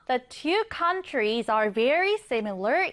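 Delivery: slow example reading. Shown as a demonstration. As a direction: read the sentence very slowly. Reads example sentence slowly. (천천히 또박또박 예문 읽기)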